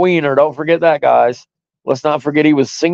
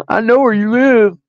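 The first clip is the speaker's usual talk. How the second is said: higher-pitched, babyish imitation of an empty threat; mocking baby voice; 7 semitones higher than usual. Host mocks rival's threat to find him, mocking baby voice. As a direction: mimic him as a whiny little baby. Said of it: "he sounded like such a baby".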